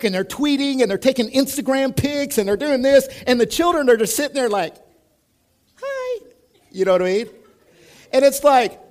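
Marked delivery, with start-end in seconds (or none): 5.8-6.3 put-on tiny, much higher child's hi; small child. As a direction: voice a lonely little kid. Ignored child at restaurant table.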